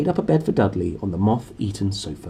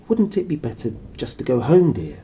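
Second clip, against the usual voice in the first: usual speaking voice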